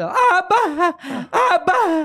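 very high, dragged-out stammer broken by laughter; mock stammering interviewee. Mocking a stutterer giving an interview, hammed-up stammer. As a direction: stretch each barra like a stutterer stuck mid-word. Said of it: "aquele gago dando entrevista".

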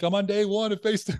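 bright, high announcing of a fresh start; chipper post voice. Retold or imitated. Quoting her own cheerful post, announcing a restart. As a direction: read out the post like good news.

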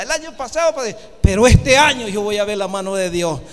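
loud, driving sermon climax; fiery preaching. Acted. Preacher rousing the congregation to faith, loud fiery preaching.

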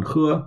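喝 held high and level; first tone. Shown as a demonstration. Teacher models "drink" for learners, 喝 high and level. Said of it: "H E in the first tone".

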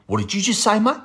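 the cop jabbing a finger, demanding; aggressive cop. Retold or imitated. Cop confronting a lanky teen about a knife, challenging.

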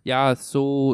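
loud mock German-class accent. Shown as a demonstration. Recalling German class, exaggerated German accent. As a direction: imitate German spoken with effort. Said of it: "ligesom da vi havde tysk".

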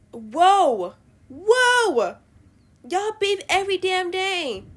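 hammed-up loud reaction to a squabble; mock exclaiming. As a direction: exclaim it big, stretching the words. Mock reaction to couples constantly arguing.

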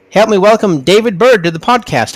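ordinary talk

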